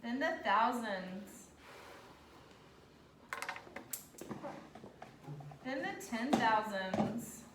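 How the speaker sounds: slow, even dictation pace. Acted. Teacher dictating place values slowly to class. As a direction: spell it out slowly so kids can write.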